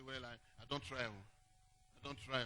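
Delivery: mock the lazy believer under your breath; mocking a doubter. Pastor mimicking a churchgoer who won't try, mumbled mockery. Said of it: "But they say".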